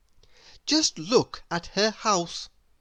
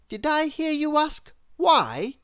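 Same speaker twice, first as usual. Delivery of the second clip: higher, louder playful question to the listener; asking the child; 10 semitones higher, 3 dB louder than usual. A narrator's reading. Narrator playfully asks the child reader. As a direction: tease the little listener. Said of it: "reading to children".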